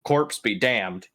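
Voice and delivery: ordinary delivery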